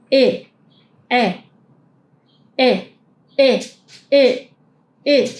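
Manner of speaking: "E" repeated with an open A mouth; German ä, jaw open; ä drill. Shown as a demonstration. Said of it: "la E solemos decir E".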